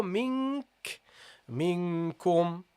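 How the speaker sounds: mink, minkum, slow and soft with a clear back kaf; quiet, careful tajweed model of minkum. Shown as a demonstration. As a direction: demonstrate minkum, landing firmly on the kaf. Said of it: "That's how you say it".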